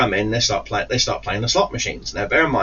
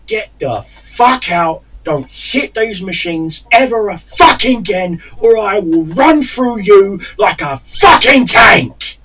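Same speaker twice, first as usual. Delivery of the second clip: slow, loud, menacing roar; furious shout; 9 semitones higher, 8 dB louder, slower than usual. Retold or imitated. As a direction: bellow the threat slowly, louder to the end. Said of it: "And I'm like".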